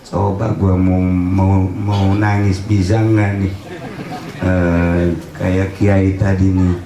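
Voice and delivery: low, level muttered self-talk; man coaxing himself to cry. Acted. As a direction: threaten yourself in a low monotone. (gumaman rendah membujuk diri)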